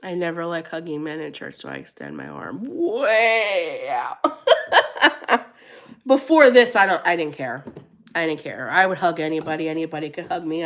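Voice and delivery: normal talking voice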